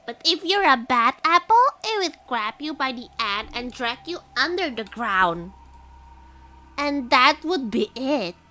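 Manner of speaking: lightly voiced old grandma, slowing at the end. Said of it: "grandma voice".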